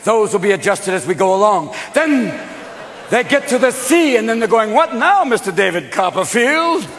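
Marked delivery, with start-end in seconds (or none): 4.7-6.8 high mock heckle; sarcastic challenge. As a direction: heckle the miracle worker, starting high.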